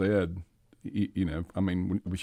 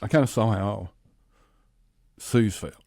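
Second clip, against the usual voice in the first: choked up; 2 semitones higher than usual. Real, not acted. Husband breaks down recalling.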